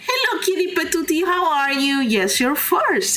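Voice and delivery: high, bright hello to a viewer; excited greeting. Real, not acted. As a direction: greet them with a lift.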